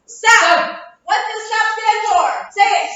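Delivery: loud call for a group response; rallying the class. Real, not acted. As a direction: fire up the class to answer aloud. Teacher leads class in chanted recap.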